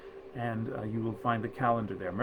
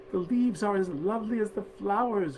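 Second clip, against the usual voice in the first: put-on children's delighted exclamation, pitched much higher; higher, delighted children's cry; 8 semitones higher than usual. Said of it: "cried they in their delight".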